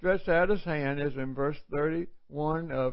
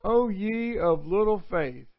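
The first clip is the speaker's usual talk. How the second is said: Jesus rebuking; 3 semitones higher than usual. Acted. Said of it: "he said".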